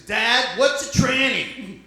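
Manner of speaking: put-on high, slow child's question; kid voice. Son asking dad about a word, high and slow. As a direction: pitch up and drag it like a curious kid. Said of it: "He came to me, he says".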